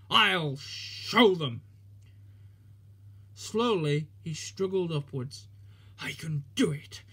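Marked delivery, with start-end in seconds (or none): 0.1-1.6 very slow, louder determined vow; defiant snort. Acted. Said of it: "he snorted". 6.0-7.0 breathless whispered puff; panted whisper. Acted. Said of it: "he panted".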